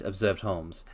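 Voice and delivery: plain reading voice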